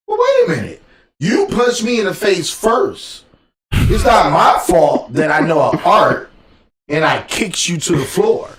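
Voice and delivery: high, loud mock self-defense; indignant protest. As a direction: plead your case in exaggerated outrage. Acted self-defense protest after a fight.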